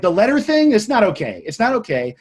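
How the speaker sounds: high, loud scolding that eases off; retold yelling. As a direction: act out telling the boss off. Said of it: "I just started yelling at her".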